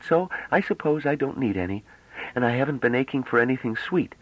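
put-on high, bright-eyed girlish voice, full of wonder; young woman Bliss, eager. A narrator's reading. Young woman marvelling at the ship, high and excited.

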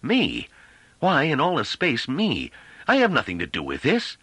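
outraged protest, voice rising; gathering rage. A narrator's reading. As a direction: let stunned disbelief build into anger. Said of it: "from stupefaction into gathering rage".

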